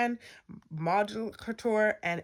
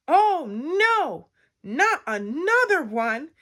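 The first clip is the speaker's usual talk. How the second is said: high, loud dismayed wail; big cartoon dismay; exasperated janitor; 7 semitones higher, 6 dB louder, slower than usual. A narrator's reading. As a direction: groan out loud at yet another one. Janitor exasperated at broken bed.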